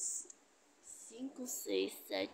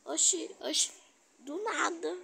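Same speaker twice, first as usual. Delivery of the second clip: loud, high indignant oxi; 5 semitones higher, 5 dB louder than usual. Real, not acted. Reacting to being called a camper, loud indignant oxi. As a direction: shout oxi in outrage.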